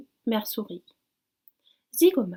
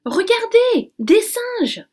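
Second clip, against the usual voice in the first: high, loud delighted cry; excited exclamation; 12 semitones higher, 10 dB louder, slower than usual. A narrator's reading. The blackbird pointing out monkeys to his friends, delighted. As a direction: call out gleefully at the monkeys. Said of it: "s'exclame Zigomar".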